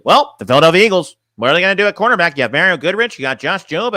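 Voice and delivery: loud opening, swooping mock-host voice; Tom impression. Streamer shows chat how a whole stream would sound, mocking host impression. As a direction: parody a host with swinging pitch. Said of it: "I should be like".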